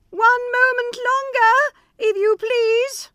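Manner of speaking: very high, slow begging; trembling plea. Acted. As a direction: stall him timidly. Wife stalls Bluebeard.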